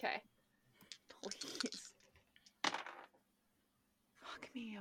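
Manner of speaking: voiceless whisper under the breath; plead, then curse in a whisper; whispered dismay. Real, not acted. Player rolling deception dice, whispered plea and curse.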